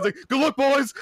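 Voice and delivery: high, loud cheerful farewell; gleeful shout. Retold or imitated. Contestant cheering as he walks off the show, loud and high. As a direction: yell it like you just escaped.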